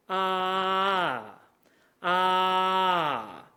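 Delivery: drawn-out demo "ah" with falling end; slide out. Shown as a demonstration. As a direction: hold "ah" at the top, let it fall. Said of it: "gonna kinda slide out".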